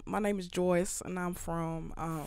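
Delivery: lightly done Texan-style American accent. Shown as a demonstration. Showing a friend an accent, soft American drawl. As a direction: put on a Texas accent, low and soft. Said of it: "I could do a good American accent".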